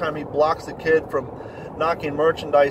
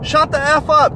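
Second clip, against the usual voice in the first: put-on much higher, loud child's yell; kid screaming; 11 semitones higher, 7 dB louder than usual. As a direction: scream it like a raging toddler. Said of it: "And this four-year-old screaming".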